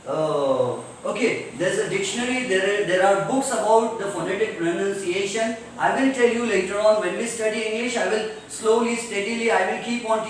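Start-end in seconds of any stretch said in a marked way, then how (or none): none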